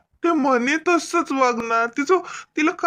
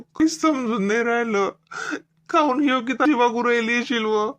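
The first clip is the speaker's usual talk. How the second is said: normal talking voice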